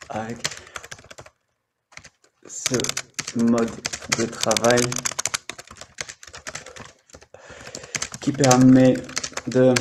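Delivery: halting, slow reading with long pauses; stiff, slow read of a written text. Shown as a demonstration. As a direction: read it out word by word, as if from a page. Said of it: "C'est quelque chose que j'écris".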